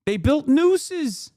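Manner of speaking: "They built nooses" drawn out slowly, pitched high; slow emphasis. Real, not acted. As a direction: spell out each word for the shock. Stressing violence against Pence.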